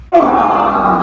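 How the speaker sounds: loud sudden scream; startled scream. Pretend. Host startled by a pretend intruder in the library, loud scream. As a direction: scream as if something jumped out.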